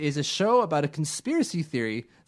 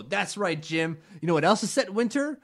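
higher, quicker hammed-up quiz-host voice; hammed-up game host; 7 semitones higher than usual. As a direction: play a cheesy host, mocking, rushing the question. Marketing host acted out.

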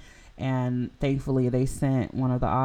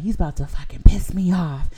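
hissed whisper, a little higher; angry whisper; 5 semitones higher, 7 dB louder than usual. Retold or imitated. Telling the nurse about the other driver in an angry whisper. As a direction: whisper it fiercely, barely holding it in.

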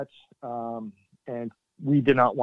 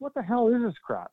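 much higher, incredulous outburst; exasperated disbelief; 10 semitones higher than usual. Retold or imitated. Soldier fed up with Air Force fuss, incredulous and much higher. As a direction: toss it off in disbelief. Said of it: "I'm like".

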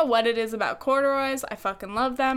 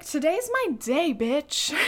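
cocky self-hype; 4 semitones higher, slower than usual. Retold or imitated. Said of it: "I was like".

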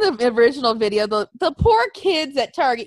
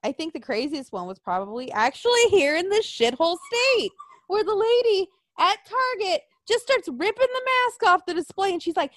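ordinary talk

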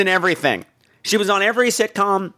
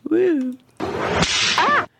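much higher whoop then breathy squeal; giddy whoop; 12 semitones higher than usual. Real, not acted. Reviewer high on pills, giddy whoop and squeal. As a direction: let out a silly giddy cry. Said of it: "I'm having fun right now".

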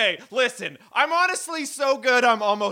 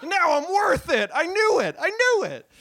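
mock triumphant; 7 semitones higher than usual. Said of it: "self-validating comic".

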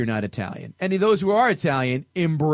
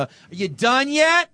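high, slow, put-upon sigh; bored wife; 9 semitones higher, 4 dB louder than usual. Retold or imitated. Bored wife mocking her husband, slow and put-upon. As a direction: drag it out, totally unimpressed. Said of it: "gives a look".

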